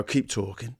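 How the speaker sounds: put-on Cockney tough guy; Cockney gangster voice. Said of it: "like, Cockney gangster voice".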